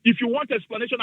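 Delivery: ordinary delivery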